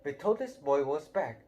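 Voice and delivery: usual speaking voice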